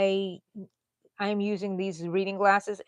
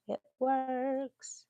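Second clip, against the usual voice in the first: higher, drawn-out Texan twang; Texan drawl; 6 semitones higher, 4 dB quieter than usual. Real, not acted. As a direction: stretch it out like a Texan. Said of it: "I feel so Texan today".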